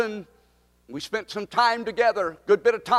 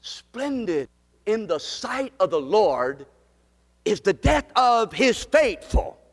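solemn recitation; 3 semitones higher, slower than usual. Acted. Pastor recites psalm verse slowly.

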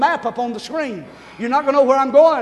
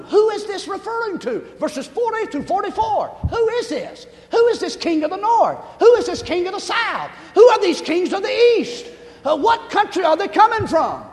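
pitched-up rhetorical who questions, building; rising preaching questions; 6 semitones higher than usual. Acted. Preacher pushing the church with who questions. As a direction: press the congregation with each who.

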